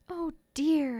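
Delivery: understated, rueful exclamation; twinge of remorse. A narrator's reading. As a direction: exclaim it with sudden regret. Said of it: "first real twinge of remorse".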